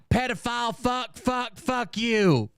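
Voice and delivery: high-pitched imitation of a cussing fit; mock ranting. Streamer imitating chatters who only curse Phil, high mock rant. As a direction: hurl mock insults in a high voice.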